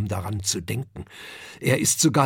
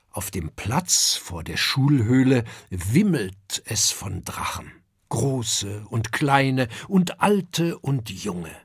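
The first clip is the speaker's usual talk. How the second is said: ordinary delivery, nothing marked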